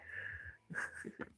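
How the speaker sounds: soft breathy chuckle; a short, quiet laugh to oneself. Real, not acted. Streamer amused by chat banter, soft chuckle.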